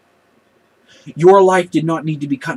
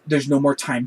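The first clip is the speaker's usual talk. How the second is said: normal talking voice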